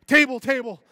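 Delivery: high, loud hammed-up tantrum; mock snapping outburst. Preacher mocks the idea of Jesus just snapping, high and loud. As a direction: play it as a mock meltdown flipping tables. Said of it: "He's like".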